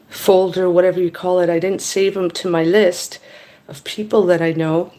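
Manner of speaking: ordinary talk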